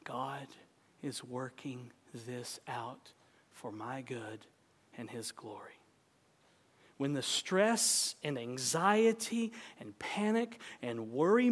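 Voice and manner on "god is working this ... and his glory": slow, soft, hushed prayer to oneself; slow, soft self-soothing mantra; quiet calming repeat. Shown as a demonstration. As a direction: murmur it to calm your soul. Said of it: "I want you to say".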